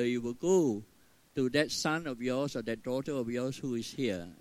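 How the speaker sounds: usual speaking voice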